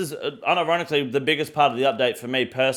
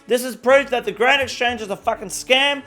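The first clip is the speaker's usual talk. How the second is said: higher, bright newsreader delivery; news anchor voice; 8 semitones higher than usual. Acted. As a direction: read it like a TV news host.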